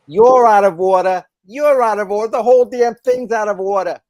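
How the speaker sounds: loud, high courtroom tirade; Pacino. Retold or imitated. Pacino's lawyer raging at the court, loud. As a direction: yell it like Pacino losing it. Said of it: "more of a Pacino".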